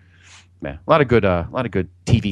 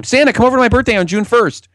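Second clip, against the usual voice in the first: put-on much higher, louder childish plea; 14 semitones higher, 5 dB louder than usual. Child asking Santa to a June birthday, high and pleading. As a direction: beg like a little kid.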